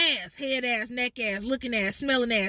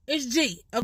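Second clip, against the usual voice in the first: usual speaking voice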